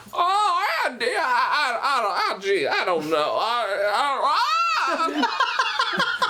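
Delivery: jittery stammer breaking into a laugh; a flustered deputy stammering, pitch climbing; stammering nervous deputy impression; Don Knotts. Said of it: "do Don Knotts".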